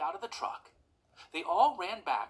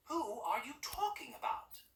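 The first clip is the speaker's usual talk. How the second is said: impatient snap; 10 semitones higher than usual. Acted. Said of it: "Doris snapped impatiently".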